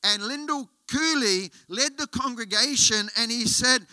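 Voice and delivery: ordinary delivery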